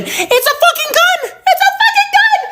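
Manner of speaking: very high squealed disbelief; horrified squeal. Acted. Horrified discovery from searching online.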